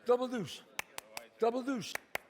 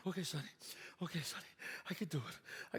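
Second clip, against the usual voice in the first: quiet self-talk; 5 semitones lower, 12 dB quieter than usual. Acted. Boy quietly psyching himself up.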